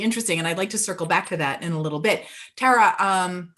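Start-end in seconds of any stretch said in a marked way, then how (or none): none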